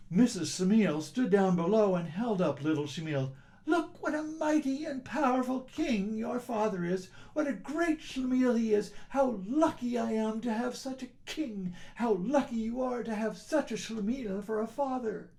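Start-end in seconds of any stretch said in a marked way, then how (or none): 3.6-15.3 put-on higher, admiring wife boasting to her child; wife's proud voice. Wife to child, praising her husband, higher and proud. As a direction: show off your husband to the baby.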